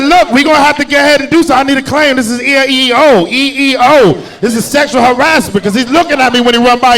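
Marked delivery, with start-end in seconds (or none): 0.0-5.6 1 mock formal complaint. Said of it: "I said".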